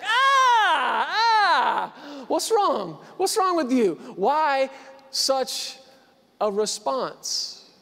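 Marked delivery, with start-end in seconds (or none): 0.0-2.2 high, loud pained cry; sharp yelp of pain. Retold or imitated. Sunburnt man slapped on back. 2.3-4.0 startled concern. Retold or imitated. Startled greeter asks.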